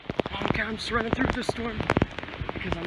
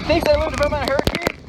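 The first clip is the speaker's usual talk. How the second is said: loud raised yell in the wind; shouting over wind; 9 semitones higher, 7 dB louder than usual. Real, not acted. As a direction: shout into the storm. Shouting in storm.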